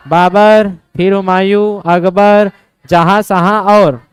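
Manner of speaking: loud, punched-out list of names; loud recitation drill. Real, not acted. Teacher prompts students to recall the emperors, loud drilling recitation.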